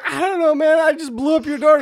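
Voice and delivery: high, quick, helpless protest; mock flustered excuse. Visitor excusing the exploded door, mock-flustered.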